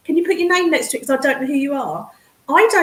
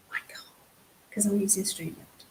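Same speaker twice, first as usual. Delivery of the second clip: breathy whispered repeat, much softer; whispered aside; 6 semitones lower, 14 dB quieter than usual. Real, not acted. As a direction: drop to a secret whisper.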